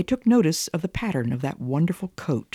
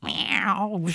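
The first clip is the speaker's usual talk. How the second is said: higher mewing cat voice; lightly voiced cat; cat meow; 5 semitones higher than usual. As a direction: mew it like a cat answering in the night. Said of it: "Simpkin said".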